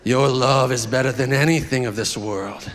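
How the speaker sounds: deep lover's voice, drawing out phrase endings. Acted. Preacher reads husband's love letter to ladies, deep crooner voice. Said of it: "read this in the Boyz two Men voice".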